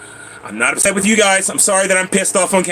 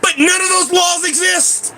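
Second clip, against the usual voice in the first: high, slow outraged shouting; exasperated shout; 10 semitones higher than usual. Real, not acted. Ranting at viewers about imaginary laws, outraged shout. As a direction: hammer home that the laws don't exist. Furious rant about the water.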